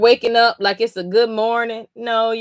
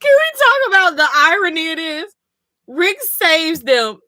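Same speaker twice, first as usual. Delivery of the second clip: incredulous; 7 semitones higher than usual. Real, not acted. Streamer ranting to chat, incredulous about the irony. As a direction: spell out the absurdity in disbelief.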